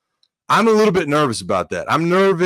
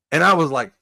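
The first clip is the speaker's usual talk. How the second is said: normal talking voice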